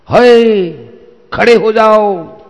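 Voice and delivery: loud, high command to halt; calling out. A narrator's reading. Unseen voice halts Arjun on the mountain, calling out. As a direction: call out sharply to stop a traveller.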